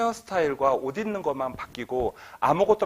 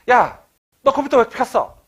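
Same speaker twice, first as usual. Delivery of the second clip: loud, high angry yell; scolding parent; 10 semitones higher, 13 dB louder than usual. Acted. Parent scolds a child for turning on the computer, yelling. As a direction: bark at the kid.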